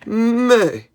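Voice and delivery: loud, high, drawn-out meh; goat bleat. Pretend. Answering what a goat says, loud high bleating. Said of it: "What does a goat say?".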